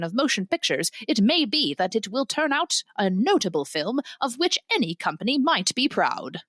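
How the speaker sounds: vintage newsreel announcer reading a review; old-timey newsman voice, measured and declamatory. Acted. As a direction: read it like an old-time newsman. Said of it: "your old-timey newsman voice".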